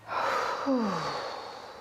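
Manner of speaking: long falling sigh; shake-off exhale. Shown as a demonstration. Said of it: "good, one more".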